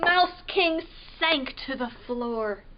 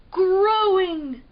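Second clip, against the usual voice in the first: higher, louder reveal; burst of wonder; 7 semitones higher, 8 dB louder than usual. A narrator's reading. Revealing the growing tree with excitement. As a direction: burst out with the surprise.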